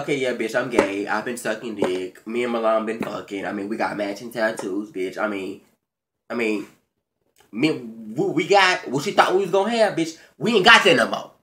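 low, offhand voice for the ex's confession; imitating the ex. Retelling the ex's confession in a low, offhand voice. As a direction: drop low and casual, like a man owning up. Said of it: "after you done dropped the ball on her and said".

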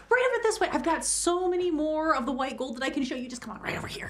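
high-pitched, gushing shop pitch; eager salesman. Acted. Employee luring a customer away from his boss, as an eager salesman.

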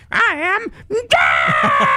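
high, slow screamed boast; screaming villain. Retold or imitated. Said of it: "they're just screaming during their intro".

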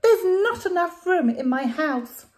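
put-on high, loud grumbling old lady. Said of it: "heard her grumble and grouse".

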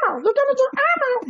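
very high, fast puppet voice; hammed-up high-pitched puppet impression; Elmo. Shown as a demonstration. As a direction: squeak it out like a kids' puppet. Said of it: "so it's like".

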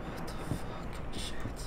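unvoiced scared whisper. Real, not acted. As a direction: breathe it out in fear.

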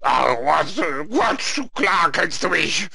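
high, loud, exasperated character voice; hammed-up indignant outburst; annoyed Death. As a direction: snap back, irritated at not being recognised. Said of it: "so ein bisschen ärgerlich".

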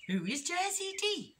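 high, curious little rabbit voice; wide-eyed storybook bunny; innocent young rabbit. A narrator's reading. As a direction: ask it high with naive curiosity.

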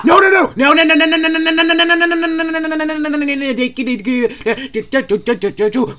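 rapid high panicked string of no's; game panic, chased by the monster, high frantic no's. Real, not acted. Panicked while being chased.

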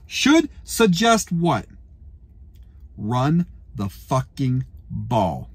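slow, low seething; slow low furious. Real, not acted. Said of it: "I'm so mad".

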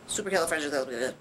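whirl through the long word, cutting off; rattled off. Shown as a demonstration. Puppet shows viewers the quick way, rattled off. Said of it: "Or you could just say".

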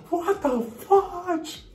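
very high, slow "what the fudge"; stunned disbelief. Retold or imitated. Recruits reacting to a knockout kick, very high and slow in disbelief. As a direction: stretch out the shock.

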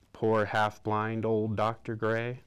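ordinary delivery

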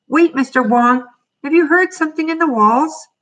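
high, urgent call to stop someone. A narrator's reading. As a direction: call after him, pitched high. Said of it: "he called".

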